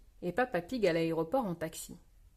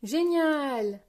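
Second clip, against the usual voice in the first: high exclamation of delight; delighted cheer; 6 semitones higher than usual. A narrator's reading. Peppa leaving on holiday, delighted cheer. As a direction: burst out with glee.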